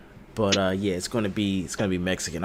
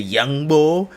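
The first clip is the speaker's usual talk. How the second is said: louder, high mock Philly accent; teasing Philly impression; mimicked Philly accent; 6 semitones higher, 7 dB louder than usual. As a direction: imitate Philly slang mockingly, pitched up. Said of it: "This is a Philly accent".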